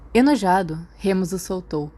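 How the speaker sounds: ordinary delivery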